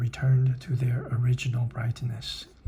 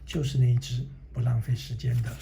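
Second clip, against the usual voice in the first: ordinary delivery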